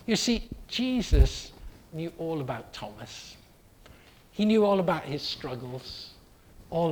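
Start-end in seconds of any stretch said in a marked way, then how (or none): none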